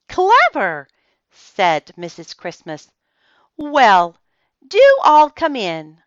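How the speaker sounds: lilting high voice of a fussing hostess; bright, excited hostess welcoming guests; Mrs Christmas. A narrator's reading.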